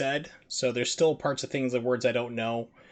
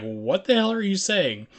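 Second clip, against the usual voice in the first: higher, louder incredulous cry; baffled outburst; 7 semitones higher, 5 dB louder than usual. Retold or imitated. Streamer voicing onlookers baffled by the accent, loud disbelief. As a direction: act out the whole room's disbelief. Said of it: "Everyone's looking like".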